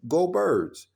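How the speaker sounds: cheery Go Birds shout; go birds greeting. Shown as a demonstration. Fan greeting a stranger on game day, upbeat call. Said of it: "people might greet you by saying".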